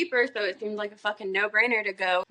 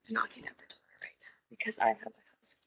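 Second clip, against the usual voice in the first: hushed, quick, voiceless whisper; whispered aside; 10 dB quieter, faster than usual. Pretend. Speaker confiding that Leviticus is at the door, whispered aside. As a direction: drop to a dramatic whisper.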